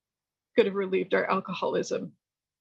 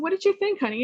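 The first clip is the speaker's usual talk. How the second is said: put-on higher, tender asking of a young woman; kind question; 10 semitones higher, faster than usual. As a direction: ask her gently like a sponsor. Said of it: "and I said".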